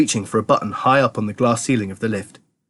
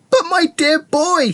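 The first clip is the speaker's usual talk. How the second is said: very high, drawn-out cry; high, stretched exclamation of joy; exploding with excitement; slower than usual. A narrator's reading. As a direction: cry out in sudden delight. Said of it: "suddenly exploded with excitement".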